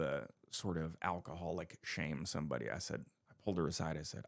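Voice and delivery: ordinary talk, nothing marked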